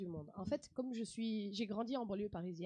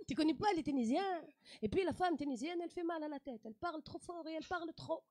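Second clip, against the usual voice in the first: higher, sing-song Tunisian lilt; sing-song Tunisian French; Tunisian accent; 7 semitones higher than usual. Shown as a demonstration. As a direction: pull on the words like a song. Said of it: "ils chantent un peu comme ça".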